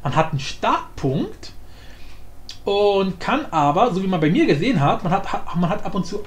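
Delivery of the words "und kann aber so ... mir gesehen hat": usual speaking voice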